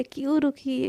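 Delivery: usual speaking voice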